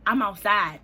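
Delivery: loud, punched "I'm outside"; emphatic announcement. Retold or imitated. Texting him outside his house, loud and emphatic. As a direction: state it loudly and firmly.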